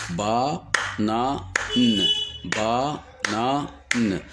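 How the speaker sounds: "banane" split into ba, na, ne with pauses; syllable by syllable. Shown as a demonstration. Teacher demonstrating three syllables, syllable by syllable. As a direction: clap out each syllable of "banane" vocally. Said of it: "Le mot banane contient trois syllabes".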